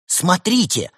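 high, loud alerting shout; startled shout for attention; sudden cry. A narrator's reading. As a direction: call out to everyone at once. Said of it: "раздался вдруг крик Евгения".

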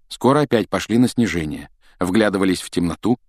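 usual speaking voice

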